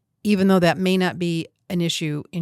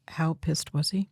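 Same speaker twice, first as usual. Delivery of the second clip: conspiratorial whisper; hushed gossip whisper; 6 dB quieter than usual. Acted. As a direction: lean in and whisper the question. Asking a juicy question conspiratorially.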